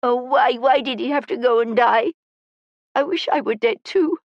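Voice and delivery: put-on high-pitched, distraught child's outburst; crying child's despairing lament, pitched high; tearful. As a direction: pour out grief on the edge of sobbing. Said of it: "and started to cry".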